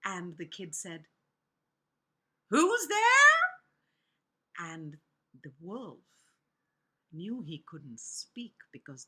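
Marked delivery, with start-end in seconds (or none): 2.5-3.6 put-on high, loud child's call; kids calling out. A narrator's reading. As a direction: pipe it up loudly to whoever knocked. Kids answer knock at door.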